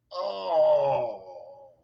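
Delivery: slow, low, soft moan of regret; dismayed groan. Retold or imitated. Gentleman regretting the fish he refused, a slow low groan. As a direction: mimic his sinking disappointment with a long low oh.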